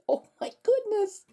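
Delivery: plain reading voice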